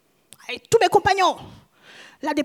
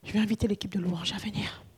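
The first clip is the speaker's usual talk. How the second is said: soft unvoiced aside; quiet whispered cue; 5 semitones lower, 12 dB quieter than usual. Real, not acted. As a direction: whisper it off-mic to the team.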